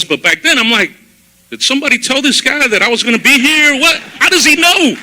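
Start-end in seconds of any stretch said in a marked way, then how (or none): 1.5-5.0 startled disbelief. Retold or imitated. Inner panic at sermon.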